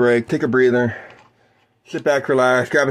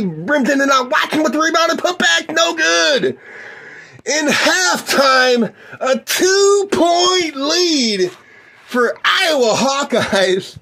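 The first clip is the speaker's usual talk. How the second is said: excited shout; much slower than usual. Acted. Calling the halftime lead to listeners, high drawn-out shout. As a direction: stretch the halftime score like a big finish. End of half called live.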